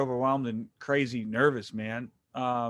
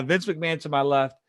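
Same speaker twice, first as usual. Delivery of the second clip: ordinary talk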